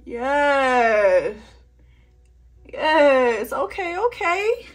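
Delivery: loud, jubilant cheer; triumphant. Real, not acted. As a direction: celebrate the win. Triumphant at the makeup.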